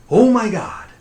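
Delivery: high, loud exclamation of annoyance; exasperated. Retold or imitated. Woken sleeper fed up with cousin's calling, loud exasperation. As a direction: burst out fed up. Acted exasperation at being called.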